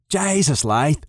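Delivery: high exclaimed Jesus in a thick northern Scottish brogue; exasperated burst in a northern Scottish brogue. Acted. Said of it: "mutters in his thick northern brogue".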